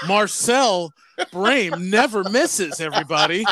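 slow, laughing grand proclamation; mock announcer. Co-host playing ref announcing foul, mock-grand. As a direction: announce it to the crowd, teasing.

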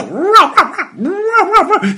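high, loud imitated barking in panic; frightened dog yelps. A narrator's reading. Little dog terrified by the tiger, frantic high yelping. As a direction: yap wildly, far above your own voice. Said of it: "小花狗吓得魂儿都飞了".

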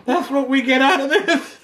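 high-pitched, breaking into laughter; laughing mockery. Real, not acted. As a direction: repeat it mockingly through real laughs. Mocks co-host's polite line, laughing.

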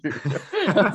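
high, bubbling laugh; amused laugh. Real, not acted. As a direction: genuine burst of high laughter. Amused by own quoted story.

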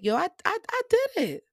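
high, jubilant "I did it"; triumphant future self. Pretend. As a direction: cheer as your proud older self. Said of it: "thinking back like".

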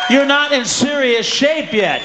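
slow, loud shout; slow loud comic bellow; bellowed punchline. Real, not acted. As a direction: hammer the line home slowly.

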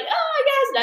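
high-pitched swooning gush; high lovestruck exclamation, hammed-up; mock gushing wife. Imitating Penelope greeting returned husband.

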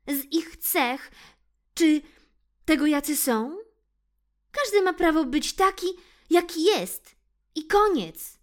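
put-on high loud scolding girl voice; proud centipede. Mona scolds the cats and boasts of her legs, high and loud. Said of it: "i dodała".

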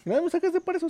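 high mock indignation; indignant retold outburst. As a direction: squeak it out like a kid asking where the food is. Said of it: "Así de".

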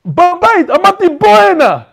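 the neighbor getting fed up and calling again; mock angry call. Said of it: "So he started getting mad".

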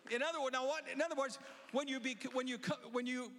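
preaching at full force. Acted. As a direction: drive it home to the crowd. Preaching climax.

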